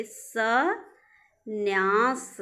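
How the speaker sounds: संन्यास split as सं, pause, न्यास; very slow syllables. Shown as a demonstration. Teacher shows students the spelling, संन्यास in drawn-out parts. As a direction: stretch both halves of संन्यास. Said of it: "संन्यास देखिए".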